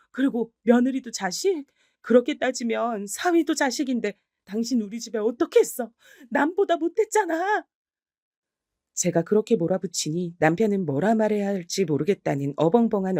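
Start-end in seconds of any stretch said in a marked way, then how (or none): 0.1-7.7 higher, scornful questioning; heated indignant rebuttal. Acted. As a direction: turn his words back on him. Wife keeps attacking husband.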